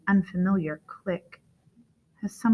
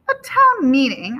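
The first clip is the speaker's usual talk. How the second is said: incredulous echo, higher and louder; startled question; 7 semitones higher, 9 dB louder than usual. A narrator's reading. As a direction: repeat the news in loud surprise. Dad echoes the news, startled.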